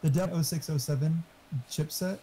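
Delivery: normal talking voice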